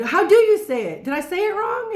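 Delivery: retelling her own startled question; surprised question. Retold or imitated. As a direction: ask eagerly if you got it wrong. Asking girl about her pronunciation.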